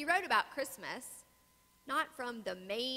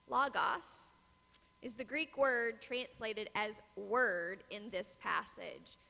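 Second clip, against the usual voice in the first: ordinary talk, nothing marked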